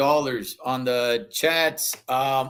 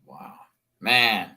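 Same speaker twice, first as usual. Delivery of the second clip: ordinary talk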